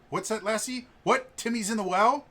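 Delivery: high, loud mock alarm; hammed-up excited reading of a dog's warning; Lassie rescue parody. Game master parodies Lassie when the scout returns, mock alarm. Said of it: "he comes back in and basically kind of a".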